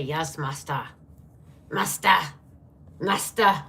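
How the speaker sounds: lightly voiced groveling servant; hint of a hunchback's master call; hunchback assistant. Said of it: "it sounded like the hunchback".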